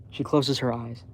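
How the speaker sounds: ordinary delivery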